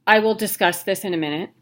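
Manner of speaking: ordinary talk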